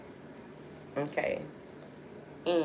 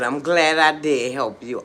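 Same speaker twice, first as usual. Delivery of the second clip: loud affirmation; 10 dB louder than usual. Real, not acted. First of two emphatic statements.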